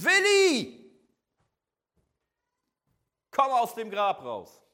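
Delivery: huge high shout into an open grave; calling out. Acted. Someone at a funeral calling the buried Willi out, shouting. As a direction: yell the name at full force, then command. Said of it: "auf einmal anfängt zu rufen".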